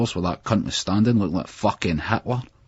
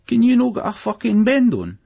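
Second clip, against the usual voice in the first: much higher, loud, urging; impatient shout; 7 dB louder than usual. A narrator's reading. Friend yelling at the others to hurry up, loud shout. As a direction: yell at them to hurry. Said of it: "Carol went to us".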